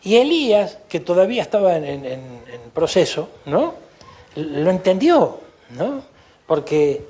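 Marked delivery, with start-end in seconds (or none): none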